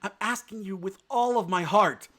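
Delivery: high, loud lovestruck plea; passionate exclamation. A narrator's reading. Bambi declaring his love to Feline, passionate and loud. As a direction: pour your whole heart into it. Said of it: "lost control of himself and exclaimed".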